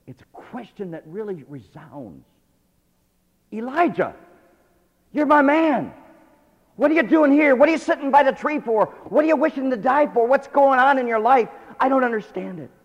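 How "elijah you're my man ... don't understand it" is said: high, loud, pleading questions; booming voice of God. Acted. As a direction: press him with loud urgent questions. God questioning Elijah, preached loudly.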